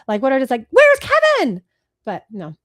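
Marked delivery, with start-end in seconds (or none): 0.7-1.6 high, loud mock-frantic cry; Catherine O'Hara. Host imitates a movie mother, high frantic shout. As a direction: cry it out in panic. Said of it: "what does Moira sound like? Catherine O'Hara".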